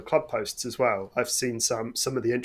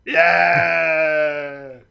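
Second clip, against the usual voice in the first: excited cheer; 4 semitones higher, 9 dB louder than usual. Real, not acted. Button sound triggered.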